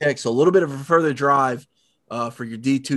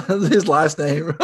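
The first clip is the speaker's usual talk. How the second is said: laughing; 4 semitones higher, much slower than usual. Real, not acted. Laughs at own mispronunciation.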